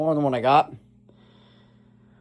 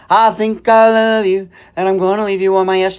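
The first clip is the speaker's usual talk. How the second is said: mock serenade; 6 semitones higher, 12 dB louder than usual.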